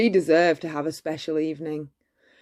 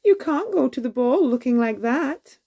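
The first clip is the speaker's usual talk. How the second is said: a little higher, lightly voiced fairy godmother; godmother scolding gently; 6 semitones higher than usual. A narrator's reading. Godmother urging Cinderella to the ball, lightly voiced. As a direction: tease her kindly as a godmother. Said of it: "she said".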